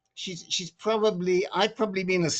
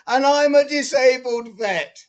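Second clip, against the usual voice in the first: raised, louder mock protest; imitating yelling; 5 semitones higher, 8 dB louder than usual. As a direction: mimic an indignant stranger calling out. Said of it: "starts yelling at me".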